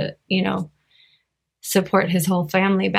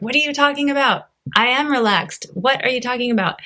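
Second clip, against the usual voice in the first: higher-pitched mock indignant protest; imitating frustrated father; 5 semitones higher than usual. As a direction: play the stubborn old father insisting he is relaxed. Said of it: "he would get so mad at me and frustrated".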